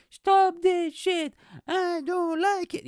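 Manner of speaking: slow, loud, high-pitched mocking yell; mock protester shout. Lone protester yelling at an arms fair, mockingly shouted. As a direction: wail it like a feeble protester. Mimics protester holding sign.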